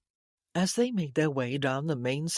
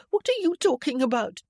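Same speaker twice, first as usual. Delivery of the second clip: high, loud, tearful protest; weepy; 9 semitones higher, 5 dB louder than usual. A narrator's reading. Accused woman to her cousin, weepy and high. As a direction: plead innocence through tears. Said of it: "She shuddered with a weepy intake of breath".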